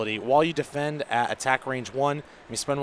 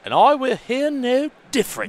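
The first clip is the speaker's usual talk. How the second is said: high, loud plummy British accent; haughty upper-class British accent; pompous British accent; 11 semitones higher, 7 dB louder than usual. Shown as a demonstration. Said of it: "a pompous British accent".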